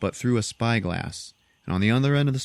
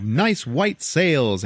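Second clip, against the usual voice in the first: slow, drawn-out, higher-pitched relish; gushing admiration; 5 semitones higher, much slower than usual. Retold or imitated. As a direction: stretch each word with showy delight. Gushes over the restored ship.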